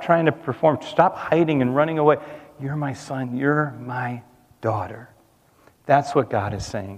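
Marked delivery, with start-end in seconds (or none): 2.6-5.1 hushed fatherly voice. Acted. Preacher voicing God tenderly to the congregation, hushed. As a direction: lean in and murmur it tenderly. Repeated take much softer.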